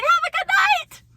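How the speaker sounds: very high, loud, fast mock-cheery farewell; all smiles. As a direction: squeal it with fake sweetness, mocking a coworker. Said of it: "she's all smiles the whole time".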